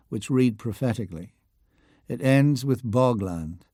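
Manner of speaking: ordinary delivery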